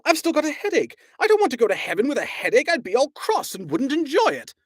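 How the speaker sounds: high panicky Arthur; panicked yell. A narrator's reading. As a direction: babble it out in rising panic. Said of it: "he yelled".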